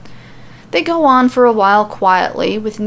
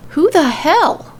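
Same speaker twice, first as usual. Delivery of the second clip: higher, slow startled cry; startled exclamation; 7 semitones higher, slower than usual. A narrator's reading. Alexa startled by a bolt whizzing past, slow exclamation. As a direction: caught off guard by a bolt. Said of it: "she exclaims".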